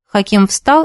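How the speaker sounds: plain reading voice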